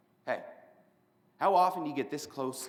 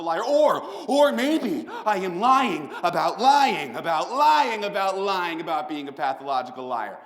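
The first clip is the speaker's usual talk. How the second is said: building manic rant; 6 semitones higher, 10 dB louder than usual. Acted. Escalating lying about lying rant.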